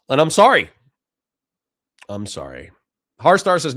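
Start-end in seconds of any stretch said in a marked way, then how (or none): 0.1-0.7 louder, higher-pitched burst of sorry; loud apology. Real, not acted. Apologising to chat for not making sense, a louder blurted sorry. 2.1-2.7 much softer, lower sorry; quiet apology. Real, not acted. Apologising to chat again, a soft, low sorry.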